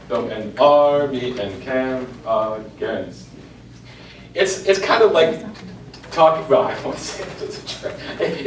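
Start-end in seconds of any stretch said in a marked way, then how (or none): 0.1-3.4 slow, careful reading in the beat; corrected rhythm demo. Shown as a demonstration. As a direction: fix the rhythm and read it out slowly.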